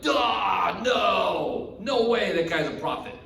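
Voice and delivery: drawn-out, exclaimed scoffing refusal; scoffing denial. Acted. Pharisees rejecting the claim of a prophet, scoffing. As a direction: reject it as utterly absurd.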